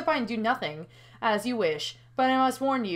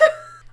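laugh-cry. Real, not acted. Laughing after the shock.

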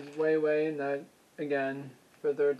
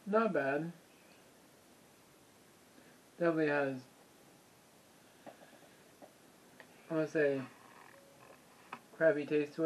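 chewing while talking; muffled eating speech; mouth full of noodles; faster than usual. Real, not acted. Seen 1: Face visible, lips pursed chewing noodles after slurping, chopsticks in bowl.

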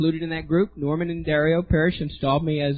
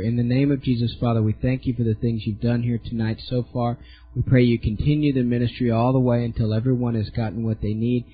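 lower, earnest prayer voice; 4 semitones lower than usual. Real, not acted. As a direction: pray it over the room. Closing prayer over congregation.

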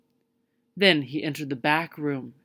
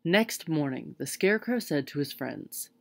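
plain reading voice

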